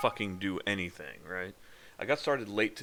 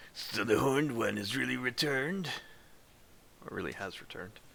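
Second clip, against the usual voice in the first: voicing a character. Voicing game dialogue.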